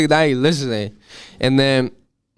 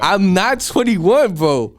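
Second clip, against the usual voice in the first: higher and slow, drawn-out alarm; retold panic; 6 semitones higher, 4 dB louder, much slower than usual. Underage at the door, slow high retold panic. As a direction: stretch it out like the jig is up.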